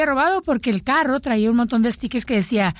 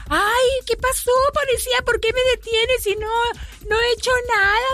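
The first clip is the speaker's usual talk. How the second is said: whiny woman voice; 12 semitones higher than usual. Acted. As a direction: play the innocent wife, pitch way up. Wife stopped by policeman, story.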